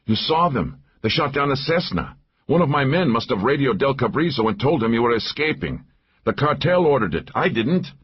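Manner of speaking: high, agitated self-defence; lightly acted breathless denial; panting protest. A narrator's reading. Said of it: "He was panting now".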